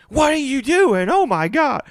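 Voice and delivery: aggressive homeowner. Retold or imitated. Angry homeowner confronting the worker in her yard. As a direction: play the pissy homeowner. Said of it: "it's like".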